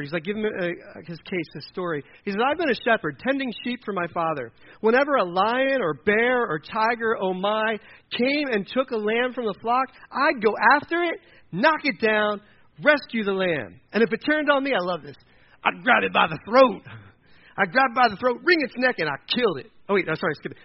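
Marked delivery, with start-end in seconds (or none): 2.4-14.7 loud, raised storytelling, punched phrases; energetic, high, emphatic exploits; fervent boasting. Acted. David boasts of shepherd exploits. 15.6-19.7 loud, punched-out bragging; forceful, emphatic warrior's tale; fierce boast. Acted. David boasts of killing beasts.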